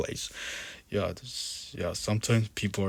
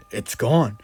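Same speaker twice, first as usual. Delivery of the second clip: louder, astonished outburst; amazed exclamation; 3 semitones higher, 7 dB louder than usual. A narrator's reading. Mister Jones discovering the demon vanished, amazed exclamation. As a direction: cry it out in disbelief. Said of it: "exclaimed Mister Jones in amazement".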